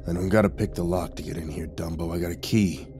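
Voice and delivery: low, soft grumble; muttering. A narrator's reading. Intruder walking past the narrator, muttering. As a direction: mutter it dismissively in passing. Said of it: "Len walked past me and muttered".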